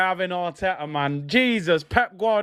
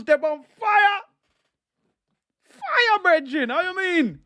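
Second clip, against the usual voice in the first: high-pitched, hyped-up exclamation; thrilled fan's high yelp; hyped cheering; 8 semitones higher than usual. Real, not acted. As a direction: cry it out in excited celebration.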